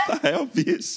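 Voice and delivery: high voice breaking into laughter; laughing. Real, not acted. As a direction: say it while cracking up. Laughs while describing police.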